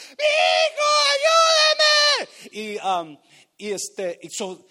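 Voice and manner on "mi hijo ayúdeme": very high, stretched-out shriek; crazed desperate scream. Retold or imitated. Mother begs for help at the well, imitated desperate scream. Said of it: "está gritando como loca, desesperada".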